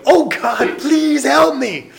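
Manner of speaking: hammed-up desperate plea; pleading prayer. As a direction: nag God desperately. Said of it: "bothered God with your prayers".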